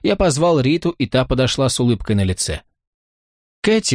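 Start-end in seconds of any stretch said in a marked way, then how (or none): 3.6-3.9 high-pitched cry of greeting; delighted exclamation. A narrator's reading. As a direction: exclaim the name as if happy to see a friend. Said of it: "воскликнула она". (радостное восклицание, высоким голосом)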